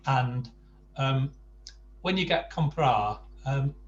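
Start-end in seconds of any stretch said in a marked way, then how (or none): none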